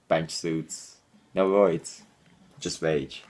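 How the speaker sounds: ordinary talk, nothing marked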